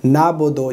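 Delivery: "नाबुदु" without the ayn; smooth "naabodo" for "नाबुदु"; common mistake. Shown as a demonstration. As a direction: say "नाबुदु" with no throat consonant. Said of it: "आइन नहीं पढ़ते".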